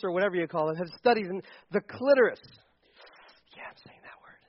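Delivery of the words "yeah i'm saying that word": sheepish whisper to the room; breath it out like a confession; whispered aside. Real, not acted. Pastor to the congregation after saying an awkward word, whispering.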